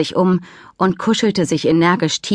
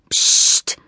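hushing. Acted. Hushing foal while hiding.

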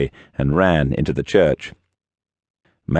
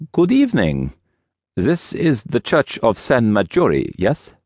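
put-on high, deliberate Russian-accented voice; strong Russian accent, pitched up; 6 semitones higher than usual. A narrator's reading. Russian visitor greets the priest at the church door, strong Russian accent. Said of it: "he had a strong accent, Russian".

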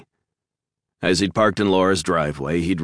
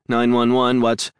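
lightly voiced calm female dispatcher, higher; measured dispatcher; 7 semitones higher, 4 dB louder than usual. A narrator's reading. Dispatcher answering the emergency call, measured and higher. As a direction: ask evenly like a dispatcher. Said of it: "A woman's measured voice inquired".

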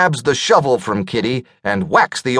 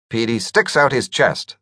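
ordinary delivery

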